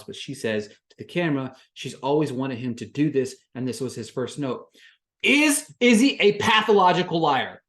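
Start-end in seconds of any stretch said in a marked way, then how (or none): 5.2-7.6 loud, high, incredulous question; exasperated outburst. Real, not acted. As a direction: throw it at the audience in disbelief. Ranting recap of reality show.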